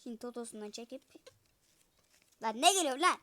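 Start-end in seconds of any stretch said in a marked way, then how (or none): none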